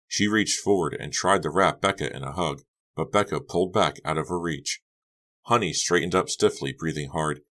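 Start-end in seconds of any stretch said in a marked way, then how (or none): none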